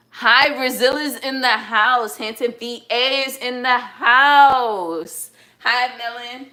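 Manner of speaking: high, drawn-out roll call; hype shout-out. Real, not acted. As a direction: draw out the shout-out like a hype host. Shout-out to viewers' places.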